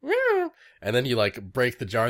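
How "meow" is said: tiny, very high mewing; excited grub cry. Retold or imitated. Guest imitates excited grubs in jars, very high mew. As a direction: squeak like a trapped baby grub. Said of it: "they get, like, excited".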